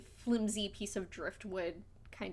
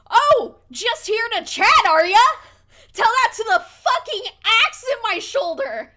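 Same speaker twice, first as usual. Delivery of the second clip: high, loud snarling hag; angry hag; 13 semitones higher, 16 dB louder than usual. Acted. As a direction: spit it out like a wounded hag. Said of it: "and she goes".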